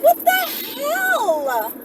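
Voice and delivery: normal talking voice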